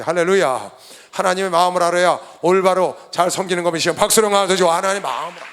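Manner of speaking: very loud exhortation; rally the congregation with a booming Hallelujah; real fervent sermon shout; full-force preaching. Real, not acted.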